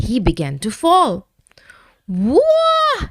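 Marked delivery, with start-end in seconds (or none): 2.1-3.1 breathless high gasp of fright; falling cry. A narrator's reading. Dragon tumbling down the mountain, crying out in fright. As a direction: let it out as he tumbles. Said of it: "he cried".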